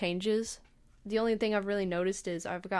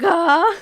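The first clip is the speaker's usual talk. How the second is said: loud, high retold scream; short mock scream of alarm; freaked-out scream; 9 semitones higher, 15 dB louder than usual. Patient seeing the stuck needle, screaming in panic. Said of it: "now I'm freaking out".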